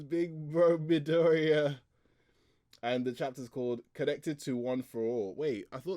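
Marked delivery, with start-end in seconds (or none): 0.0-1.8 high, drawn-out mock call; affectionate calling. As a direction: stretch it slowly in a higher imitated voice. Acts girl calling her big bro.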